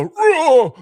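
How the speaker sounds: loud, high mock death roar; death cry. Father in the stepped-on house, hammed-up death cry. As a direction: bellow a hammed-up dying yell. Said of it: "this death cry".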